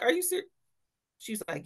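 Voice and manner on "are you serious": high, startled "Are you serious?"; high shocked question to the poison control lady; stunned disbelief. Retold or imitated. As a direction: shoot the pitch up in surprise. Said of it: "I was like".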